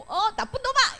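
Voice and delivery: high-pitched mock telling-off; mock scolding. Teacher acts out a stranger abusing an innocent child, mock scolding. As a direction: play someone suddenly hurling abuse. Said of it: "이러면서 욕을 하고".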